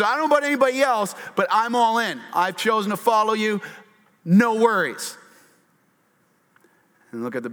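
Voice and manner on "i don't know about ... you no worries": quick, high, loud all-in promise; fast eager boast. Retold or imitated. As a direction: brag he'll never fall away, rushing. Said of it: "He says".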